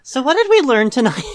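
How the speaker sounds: shouted through laughter; big laughing wrap-up question. Real, not acted.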